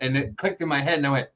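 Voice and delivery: ordinary talk, nothing marked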